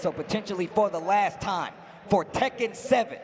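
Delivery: hype announcer. Real, not acted. Hyping crowd.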